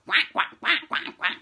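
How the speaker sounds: loud rapid quacking; loud duck quacking; duck quacks. Acted.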